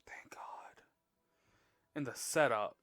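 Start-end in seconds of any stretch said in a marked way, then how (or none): 0.1-0.8 breathy whispered relief; relieved whisper. Real, not acted. Relief after tense scene.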